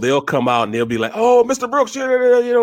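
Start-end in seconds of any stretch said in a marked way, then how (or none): 1.1-2.6 high-pitched fan greeting; imitated excited kids. Boys greeting the former Eagle, imitated excitement. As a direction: gush like a boy spotting a player.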